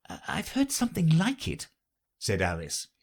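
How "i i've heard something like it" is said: put-on high young girl voice. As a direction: voice Alice as a girl. Alice voiced in story.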